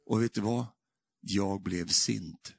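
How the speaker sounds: ordinary delivery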